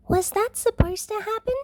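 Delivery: put-on high little girl's voice, a hushed question; small whisper. A narrator's reading. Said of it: "Eri-chan whispers".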